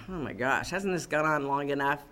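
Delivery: low, soft exasperated grumble; mock impatience, kept low and soft; weary aside. Preacher as Elijah watching the prophets, weary aside.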